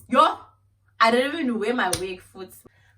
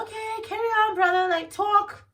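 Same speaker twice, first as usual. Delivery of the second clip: sarcastic go-ahead; 10 semitones higher, 4 dB louder than usual. Retold or imitated. Mock-encouraging the boyfriend.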